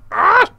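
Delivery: sudden high yelp of frustration; short high scream. Real, not acted. Gamer crashing upside down, high yelp. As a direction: yelp as it goes wrong.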